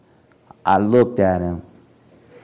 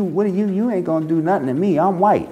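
mock taunting woman; 7 semitones higher, faster than usual. Woman taunting him at curb.

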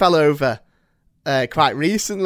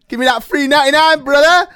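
shout-out; 11 semitones higher, 7 dB louder than usual. Acted. Shout out to ice cream man.

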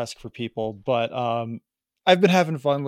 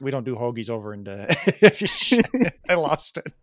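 normal talking voice

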